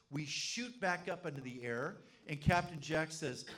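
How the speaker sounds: ordinary talk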